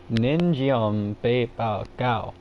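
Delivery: "Nin Jiom Bei Bao Gao" slowly, tried with Chinese tones; careful attempt at Chinese pronunciation of "Nin Jiom Bei Bao Gao"; Chinese accent attempt. Shown as a demonstration. Second try at the syrup name, aiming for Chinese accent. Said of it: "try to say it with an accent".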